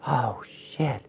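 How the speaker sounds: breathless whispered oath; awed whisper on seeing her; stunned whisper. Acted. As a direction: whisper it in shock. Stunned recognition of father.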